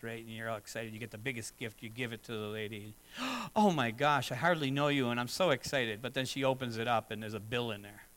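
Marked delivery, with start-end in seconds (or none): 3.0-3.5 a high, sharp delighted gasp; playful imitated gasp of delight; mock gasp. Acting the bride receiving gift. 3.5-6.0 louder, gushing delight; hammed-up thrilled thank-you; mock gushing. Acting the bride receiving gift.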